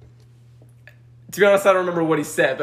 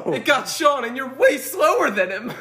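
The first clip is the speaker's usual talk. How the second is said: high, incredulous pleading; raised-pitch warning; mock panicked protest; 8 semitones higher than usual. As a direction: plead in retold alarm, on the edge of laughing. Acted panicked plea to leader.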